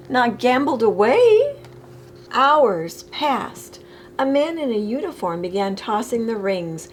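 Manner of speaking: ordinary delivery, nothing marked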